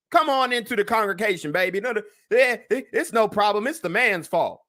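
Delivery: hammed-up welcoming congregation member; mock church welcome. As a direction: wave them in with mock forgiveness. Acts out an indulgent church welcome.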